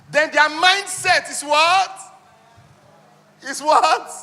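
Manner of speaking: high, loud and drawn out, asking the class to answer; slow booming teacher question; loud quizzing question. Real, not acted. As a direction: pull the answer out of the room with a long rising call.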